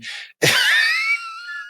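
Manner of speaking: sudden high shriek of laughter; cracking up helplessly mid-sentence; burst of laughter. Real, not acted. Host loses it reading absurd claim.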